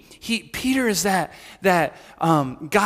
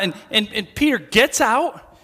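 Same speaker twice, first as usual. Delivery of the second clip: normal talking voice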